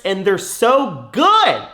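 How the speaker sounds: high, loud, slow and drawn out; slow, loud, high gush over the taste. Real, not acted. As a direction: rave about the candy. Hyping her own candy.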